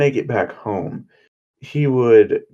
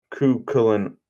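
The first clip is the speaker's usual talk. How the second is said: plain reading voice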